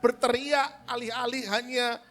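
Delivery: loud, high preaching peak; forceful preaching. Real, not acted. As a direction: drive the point home hard. (khotbah berapi-api, suara keras)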